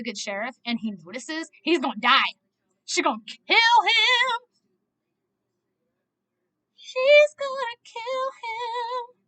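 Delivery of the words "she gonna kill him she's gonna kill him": high, slow, drawn-out lament; wailing dread. Real, not acted. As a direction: stretch it into a high wail of dread. Dreading the sheriff's death.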